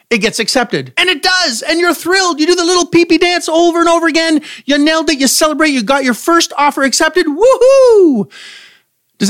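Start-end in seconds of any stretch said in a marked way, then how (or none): 1.0-7.3 high, fast and thrilled; excited. Pretend. Celebration after acceptance. 7.3-8.3 high, loud woohoo; loud cheer. Pretend.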